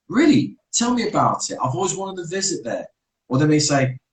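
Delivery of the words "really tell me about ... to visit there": high, eager "Really?" that jumps up; delighted surprise. Pretend. Someone meets a visitor with a new accent, delighted surprise. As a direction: gush with interest at the stranger's home country. Imagined chat with a foreigner.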